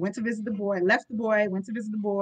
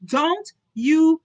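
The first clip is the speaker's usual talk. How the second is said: put-on high, loud child's voice; small boy speaking; 7 semitones higher, 8 dB louder than usual. A narrator's reading. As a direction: push the words out like a defiant little boy. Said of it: "his little chin jumped out as he said".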